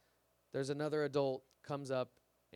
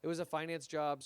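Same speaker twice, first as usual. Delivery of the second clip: ordinary delivery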